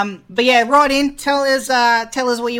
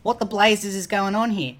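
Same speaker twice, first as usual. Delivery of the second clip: ordinary talk, nothing marked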